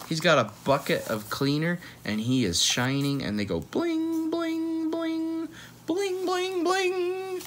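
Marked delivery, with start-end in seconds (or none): 3.6-7.4 high sing-song bling bling; sparkly bling sound. Retold or imitated. Reading to a child, high sparkly shine sounds. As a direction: make the desks sparkle with your voice.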